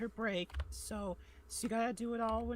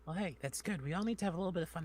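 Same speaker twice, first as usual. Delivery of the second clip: ordinary delivery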